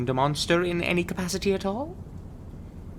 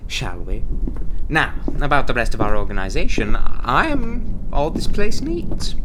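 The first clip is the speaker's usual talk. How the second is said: projecting to fill the space; big, loud, encouraging interviewer voice; loud coaching; 2 semitones higher, 9 dB louder than usual. Acted. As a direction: urge a timid recruit to speak up, loudly. Urges recruit to speak louder.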